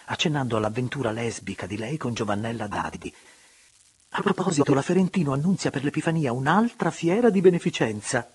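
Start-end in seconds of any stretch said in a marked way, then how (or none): 4.2-8.3 higher, louder society gossip; chatty baron. A narrator's reading. The baron announcing the charity fair to the guests, lively and raised. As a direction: announce the news to the table.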